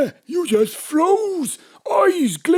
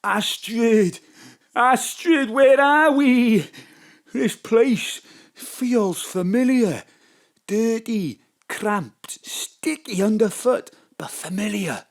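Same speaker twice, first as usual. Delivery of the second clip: lightly voiced John; 5 semitones lower than usual. John wakes somewhere familiar, low and awed. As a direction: wonder at a strange place.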